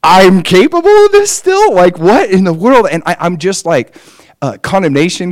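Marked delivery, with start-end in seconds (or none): 0.0-2.9 high, incredulous cry of disbelief; shocked disbelief. Retold or imitated. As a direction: gasp it out, stunned at yourself. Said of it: "I'm shocked at my own".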